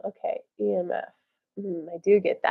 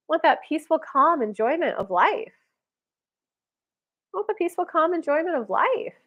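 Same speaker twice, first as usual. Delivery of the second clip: channeled spirit voice; 3 semitones higher than usual. Acted. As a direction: deliver it as the spirit's message to the viewer.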